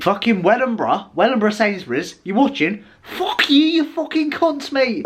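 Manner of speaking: high, shouting fury straight at the camera; cursing at the store staff in real outrage, pitched high; furious rant. Real, not acted. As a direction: really let rip at the store, pitch way up. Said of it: "That pissed me off".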